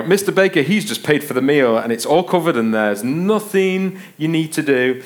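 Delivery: imagined waiter, slowing for the final reassurance; deferential waiter. Acted. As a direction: announce it grandly for the whole table to hear. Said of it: "like they're talking to your dad".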